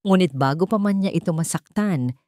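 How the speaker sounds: ordinary delivery, nothing marked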